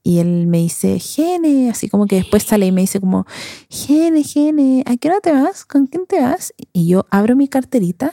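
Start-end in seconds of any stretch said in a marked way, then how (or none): 1.0-1.7 high, sing-song call of her name; boy calling her name. Retold or imitated. The boy at the party calls out to her, high call. 3.7-6.5 high, eager voice asking questions; imitating the boy. The boy at the party pesters her about leaving, high eager voice.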